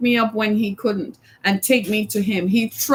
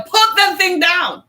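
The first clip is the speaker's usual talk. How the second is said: parent yelling; 6 semitones higher, slower than usual. Retold or imitated. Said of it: "heard a parent yell".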